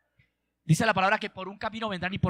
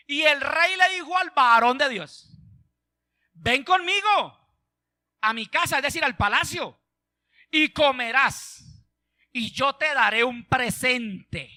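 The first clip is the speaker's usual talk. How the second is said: slow, drawn-out declamation; dramatic scripture reading; 4 semitones higher, 5 dB louder, slower than usual. Real, not acted. Preacher reading the king's invitation to the congregation, declamatory. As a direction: stretch out each phrase emphatically.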